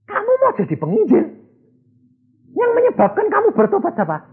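high, incredulous disbelief; can't believe what he's hearing; astonished question. Retold or imitated. The businessman asks the young man, high and astonished. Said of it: "Hah? Apa?".

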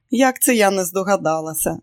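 usual speaking voice

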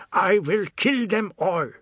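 fury dissolving into tears; breaking into sobs. Acted. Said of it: "his voice broke into sobs".

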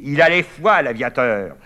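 high, loud sneering taunt; loud mockery. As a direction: jeer at the coward. Mocking Michel as he leaves. (moquerie criée et méprisante)

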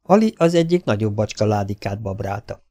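usual speaking voice

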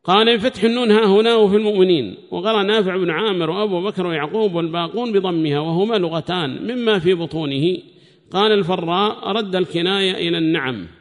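loud, high-pitched recitation of a book; formal text reading. Acted. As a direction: read the text out loudly to the class. Reads the commentary text aloud.

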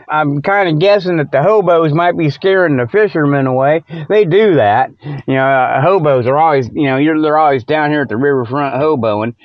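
ordinary talk, nothing marked

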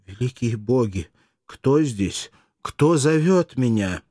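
rising from uneasy to a loud frightened cry; a girl in the dark asks, scared, who is there; frightened call. Acted. Mirdza among the dead calls out in fear. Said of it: "она спросила".

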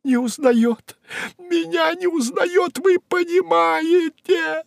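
very high, tearful wail; sobbing outcry. Acted. Secretary unrecognised by her boss, sobbing outcry. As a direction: wail it slowly, high-pitched, as if crying. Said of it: "взрыдала секретарь".

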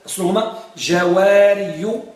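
يقول جواري ببطء مع الضمة على الياء; جواري ممدودة بضمة ظاهرة على الياء; drawn-out jawāriyu. Shown as a demonstration. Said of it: "ركز على السؤال". (نطق جواري ببطء وبضمة على الياء)

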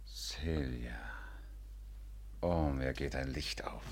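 slow, hushed realization; stunned whisper. Acted. Man realizing he was betrayed, stunned whisper. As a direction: let it dawn on you quietly.

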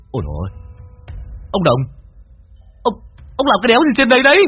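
stammered surprise building to a fast yell; startled disbelief. A narrator's reading. Cậu finds the old man hanging in the tree, startled disbelief. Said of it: "giật mình".

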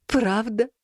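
hopeful teary sniffle, rising; snotty crying. A narrator's reading. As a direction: ask through a runny nose. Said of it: "снова шмыгнул носом кот". (всхлипывая, с надеждой)

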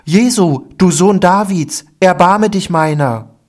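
high, loud beggar's cry for mercy. A narrator's reading. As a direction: call out desperately to be heard. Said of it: "fing er an zu schreien".

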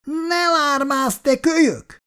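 high, slow, shrill-voiced scolding neighbour woman; angry woman's snap. A narrator's reading. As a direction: snap at the noisy kid from a window. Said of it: "Felcsattant egy mérges női hang".

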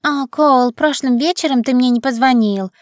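high squeaky girly imitation; mock a whiny girlfriend, squeaky and high. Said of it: "писклявым голосом изображает Кара Грейс".

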